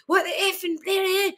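high, hammed-up hard Scottish challenge; tough Scottish. Confronting kids in a mock-angry Scottish accent. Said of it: "I go".

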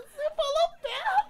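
very high, loud wail; hammed-up squealing accusation; stoned wife. Stoned wife accusing husband of saying pergola, loud high mock wail.

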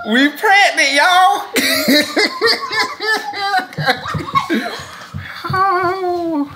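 laughing, high joyful cry; overjoyed. Real, not acted. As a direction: shout it out through happy tears. Result revealed, overjoyed.

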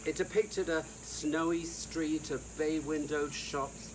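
ordinary delivery

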